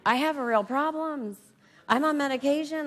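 high, loud, indignant protest. Retold or imitated. Woman scoffing at the inner voice's yoga idea, loud and indignant. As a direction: scoff at the idea like it's absurd. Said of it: "I was like".

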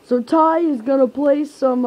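put-on slow, loud old grandpa voice; a hint of a creaky old man. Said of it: "trying to get out of the grandpa voice".

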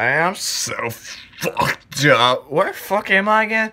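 lightly acted slurred, drawn-out confusion; wasted and confused. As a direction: play it dazed, stretching the words out. Retelling himself wasted and confused.

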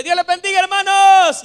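high, loud shout to the congregation; shouted church hello; shouted greeting. Shown as a demonstration. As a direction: belt out a hearty greeting at full voice. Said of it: "yo saludo gritando y diciéndole".

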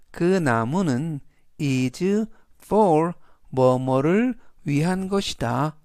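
ordinary talk, nothing marked

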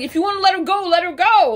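high and loud, fed-up retort; fed-up answer to a pointless question; exasperated. Retold or imitated. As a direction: snap at the cop in frustration. Snapping back at the cops.

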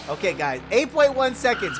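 usual speaking voice

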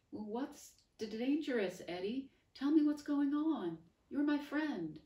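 high, loud, desperate pleading; pleading friend. Acted. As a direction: beg her to stay. Wilfred pleading, voice ringing after.

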